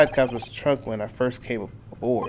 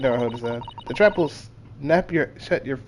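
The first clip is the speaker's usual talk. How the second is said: normal talking voice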